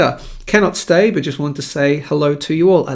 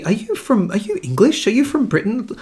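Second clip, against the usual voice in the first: higher-pitched puzzled questioning; higher, inquisitive imitation of strangers; curious questioners; 6 semitones higher than usual. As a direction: lightly mimic people asking where you're from. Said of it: "people would keep asking me".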